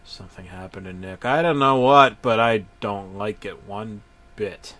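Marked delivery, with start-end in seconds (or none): none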